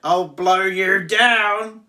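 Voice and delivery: loud, high, slow hammed-up wolf; wolf threat. Wolf threatens the brick house, loud and slow.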